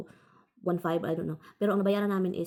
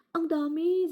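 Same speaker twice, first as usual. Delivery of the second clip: high-pitched alarm; alarmed exclaim; 9 semitones higher, much slower than usual. Retold or imitated. Repeating to her husband how many there are, alarmed. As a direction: blurt it out in alarm. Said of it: "sabi ko".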